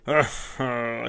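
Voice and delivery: a loud, wild roar from the mad host. A narrator's reading. Mad tavern host roars over the corpse, loud bellow. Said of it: "he roared".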